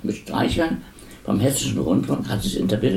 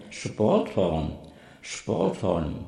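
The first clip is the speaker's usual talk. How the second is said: quiet echo; 6 semitones lower, 7 dB quieter, much slower than usual. Shown as a demonstration.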